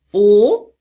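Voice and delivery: "O" loud and drawn out, rising at the end; held O vowel. Shown as a demonstration. Teacher showing students the starting mouth position, held O. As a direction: hold a clear, rounded "O". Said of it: "você precisa falar o O".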